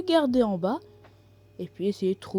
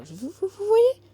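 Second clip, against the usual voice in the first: high, loud, stammering excitement; excited; 11 semitones higher, 6 dB louder than usual. Real, not acted. Excited about villain's aura.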